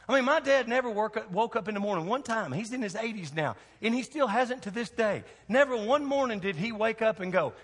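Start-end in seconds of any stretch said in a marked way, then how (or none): none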